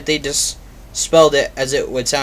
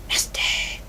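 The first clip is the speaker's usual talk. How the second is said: whispered; 6 dB quieter than usual. Real, not acted. Disgust at bad breath.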